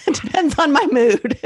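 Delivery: amused, high-pitched laughing delivery. Real, not acted. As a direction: say it through a real giggle. Joking about saying it both ways.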